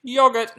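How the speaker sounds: high, loud "Yogurt" with short O, YOG-urt; punched-up British YOG-urt for "Yogurt". Shown as a demonstration. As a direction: pipe up "Yogurt" in exaggerated British style. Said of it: "It sounds so British".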